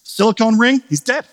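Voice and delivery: he's dead said high with a rising tune; high punchline. Real, not acted. Punchline about silicone ring.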